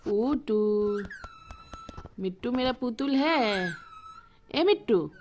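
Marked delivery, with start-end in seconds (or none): 0.0-1.1 slow modelled word. Shown as a demonstration. Teaching the pet parrot its name, slow and drawn out. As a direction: say Putul syllable by syllable for the parrot to copy. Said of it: "Putul बोल दे बेटा".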